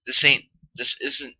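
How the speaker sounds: usual speaking voice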